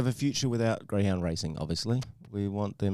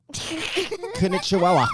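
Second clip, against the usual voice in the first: slow drawn-out punchline; 10 semitones higher, 4 dB louder, much slower than usual. Acted. Repeats the punchline.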